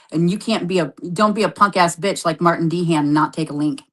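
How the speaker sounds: usual speaking voice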